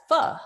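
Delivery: "ph" said as fuh; isolated "ph" pronounced fuh; F sound. Shown as a demonstration. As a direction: say "ph" the English way, as F. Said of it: "Like an F sound".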